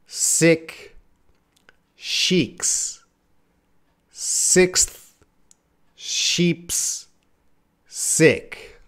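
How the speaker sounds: very slow, one word at a time, with gaps. Shown as a demonstration. Showing viewers the twister slowly, word by word. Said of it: "That's it slow".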